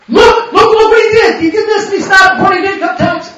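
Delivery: high, loud mock snitching; tattling informer. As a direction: rat someone out in an excited high voice. Acting the informant ratting to IRS.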